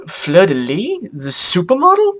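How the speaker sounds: rising to a high, loud squeal; astonished. Acted. Octavia learning the supermodel's secret, shrill-rising astonishment. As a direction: ask in escalating disbelief. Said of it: "My eyes bulged".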